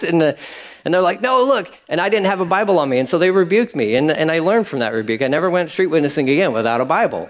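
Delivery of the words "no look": insistent buddy. Retold or imitated. Buddy voice again.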